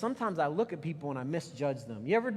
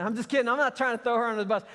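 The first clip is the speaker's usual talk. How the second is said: loud, fast, laughing it off; quick playful backpedal; joking aside; 6 semitones higher, 6 dB louder, faster than usual. Real, not acted.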